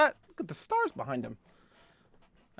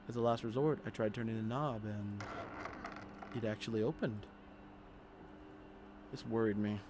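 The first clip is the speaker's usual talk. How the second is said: lower, measured reading voice; story narration; 3 semitones lower, 7 dB quieter than usual. Acted. Player reading horror game text, in subdued narration. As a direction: read the horror story aloud evenly. Reads the game's story aloud.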